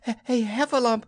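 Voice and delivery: high, panting stammer; squeaky winded piglet struggling to get the word out; Piglet out of breath. Acted. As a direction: gasp between attempts, hardly able to speak. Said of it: "breathing so hard that he could hardly speak".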